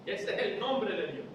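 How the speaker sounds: ordinary talk, nothing marked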